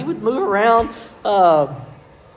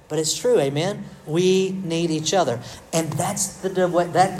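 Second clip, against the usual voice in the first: usual speaking voice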